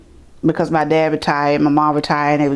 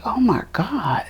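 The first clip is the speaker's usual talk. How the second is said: whispered shock; 4 semitones higher, 4 dB quieter, slower than usual. Retold or imitated. Retold shock at welfare office.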